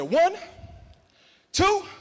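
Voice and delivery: high, loud counting; bold countdown. Retold or imitated. Speaker counting before the jump, loud high count. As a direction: call the count to psych yourself up.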